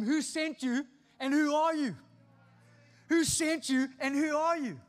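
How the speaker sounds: challenging cross-examination; interrogator. Acted. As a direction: interrogate an intruder, loud and high. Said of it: "stop them and interrogate them".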